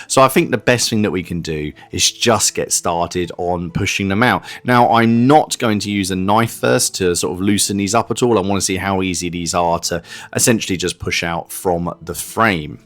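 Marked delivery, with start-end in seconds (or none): none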